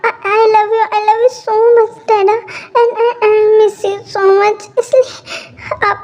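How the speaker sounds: slow, high and tearful, words dragged out; a daughter crying over leaving her dad. Acted. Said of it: "why you are crying".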